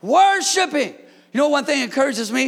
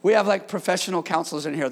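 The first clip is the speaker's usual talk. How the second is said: ordinary talk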